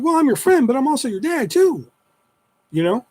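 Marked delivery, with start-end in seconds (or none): 0.0-1.9 very high, sing-song parent voice; talking to a child. Retold or imitated. Dad explaining to young daughter, child-directed voice.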